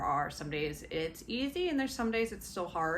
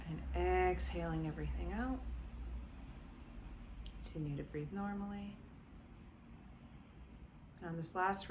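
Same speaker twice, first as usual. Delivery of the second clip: guided meditation. Acted. Coach guiding listeners through body relaxation, gently soothing. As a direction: ease them down into calm, step by step.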